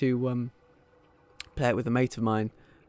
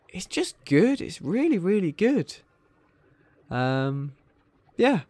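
excited; 6 semitones higher, 3 dB louder, slower than usual. Real, not acted. Gushing about the game.

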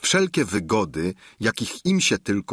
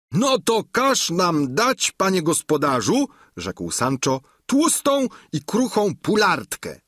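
higher, louder squire's voice; a plain-spoken squire, higher and louder; Sancho's voice; 9 semitones higher, 3 dB louder than usual. Acted. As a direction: Sancho voiced high and loud. Sancho orders a capon.

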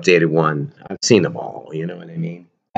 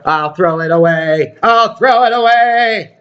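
mock threatening wife; 9 semitones higher than usual. Imitating the wife threatening to throw the toy away, mock. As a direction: threaten to bin it, rising shrill. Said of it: "She's go".

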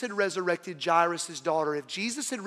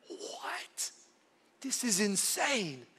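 astonished whisper; 11 dB quieter, much slower than usual. Acted. Crowd astonished at the tomb.

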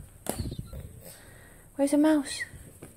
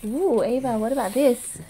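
ordinary talk

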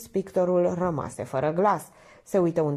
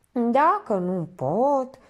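high, slow pouting whine; sulky; 7 semitones higher, 4 dB louder, much slower than usual. A narrator's reading. Minerva refusing to tell the painter, sulky. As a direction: drag it out with a pout. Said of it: "se bosunflă Minerva".

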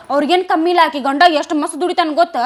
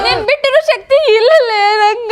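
hammed-up crying plea; 4 semitones higher than usual. Sister pleads her sister to stay.

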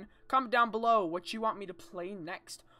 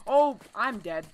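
scared horror game streamer; 8 dB louder, slower than usual. Real, not acted. Caught by animatronic.

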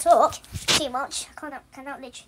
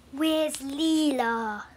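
ordinary talk

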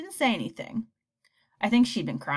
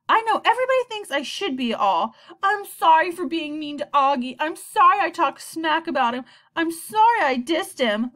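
loud sarcastic mock apology; 8 semitones higher, 6 dB louder than usual. A narrator's reading. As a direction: sneer out the fake apologies. Mocking expected apology.

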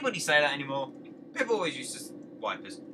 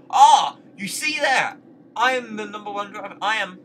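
excited boast; 4 semitones higher, 10 dB louder than usual. Real, not acted. Proud of his driving.

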